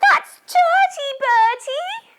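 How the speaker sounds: very high, loud big-sister shout; Susie. Acted. Big sister telling Bertie off over worms, shouting. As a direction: shriek it like a cross big sister. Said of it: "big sister Susie would shout".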